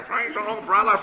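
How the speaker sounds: high parrot squawk, loud on the last word; excited parrot. Acted. Parrot having a good old time, high squawk. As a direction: squawk it high and punch the end. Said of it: "The parrot going".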